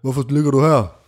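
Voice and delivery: a hint of an officer questioning a sleeper; lightly voiced policeman. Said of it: "vækket af en politimand i sengen".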